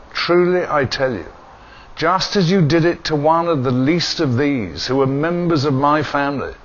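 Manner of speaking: normal talking voice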